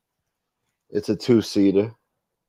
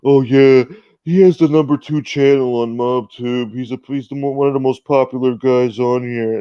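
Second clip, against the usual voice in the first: loud, raised parody of a gushing follower; mocking dim-witted fan; 4 semitones higher, 6 dB louder than usual. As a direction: imitate a clueless fanboy's boast. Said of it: "douchebags with Down syndrome saying".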